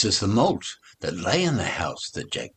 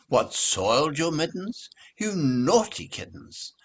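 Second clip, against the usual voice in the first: high scolding mother cat; 8 semitones higher than usual. Acted.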